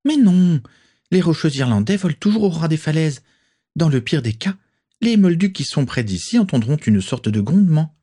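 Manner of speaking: lightly voiced witches, reassuring then ready; young witches talking. A narrator's reading. Friends' dialogue voiced.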